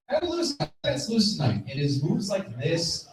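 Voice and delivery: usual speaking voice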